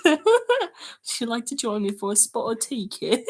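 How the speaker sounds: slow, hammed-up British accent; mock British tea-time accent. Shown as a demonstration. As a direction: do a badly faked British gent, giggling. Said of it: "What does a British accent sound like?".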